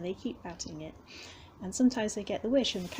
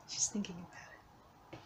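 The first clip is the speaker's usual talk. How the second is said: coaxing whisper; 2 semitones lower, 9 dB quieter than usual. Real, not acted. Whispered aside near cat.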